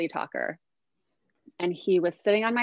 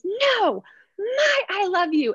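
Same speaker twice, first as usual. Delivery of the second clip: put-on high, louder angry toddler cry; toddler scream; 13 semitones higher, 5 dB louder, slower than usual.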